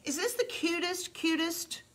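high gushing praise for the board. Real, not acted. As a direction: keep the delight soaring up high.

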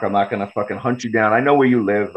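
ordinary talk, nothing marked

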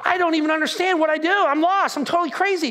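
high, hurried exasperation; acted frantic confusion. Frantic paraphrase of confused Paul.

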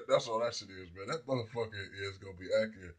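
ordinary talk

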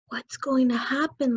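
plain reading voice

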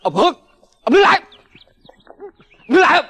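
to the fleeing neighbour, a loud command to stop; shouting stop. Acted. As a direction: yell at him to freeze where he stands.

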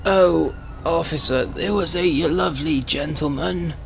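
put-on a doddery old Briton protesting politely; old man voice. Old man voice denying to the officer.

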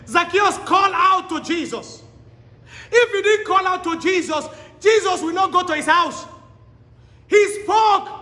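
fiery sermon delivery; full-force preaching. Acted. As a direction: preach it to the back row. Preacher exhorting the congregation.

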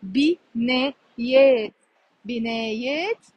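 slow, drawn-out بنايات; slow demo. Shown as a demonstration. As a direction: stretch out بنايات syllable by syllable. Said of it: "we will say بنايات".

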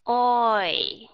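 "Oi" with both vowels held clearly; "Oi" drawn out, two clear sounds. Shown as a demonstration. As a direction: glide slowly from o to i. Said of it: "Ở trong này có mấy âm".

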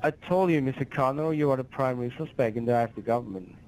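ordinary talk

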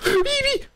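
high-pitched frightened shriek; scared scream. Real, not acted. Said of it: "T'as vu mon cri?".

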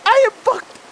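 high panicked cry; yelping that he is doomed; panicked high wail. Real, not acted. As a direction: wail it out in real dread. Panicking at horror game.

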